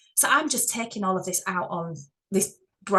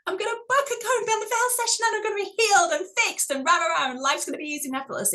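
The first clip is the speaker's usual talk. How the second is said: high, fast mock-excited gush; mocking naive hope; 11 semitones higher, faster than usual. Spiritual coach mocking clients expecting instant healing, high excited gush. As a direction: parody a client expecting a quick fix. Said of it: "I don't want to set your expectations like".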